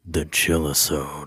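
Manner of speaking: slow, hushed and eerie, drawn out; creepy slow announcer voice that gives goosebumps; creepy spooky voice. Acted. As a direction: say it like it's a ghost story title. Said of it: "Is it a little too creepy?".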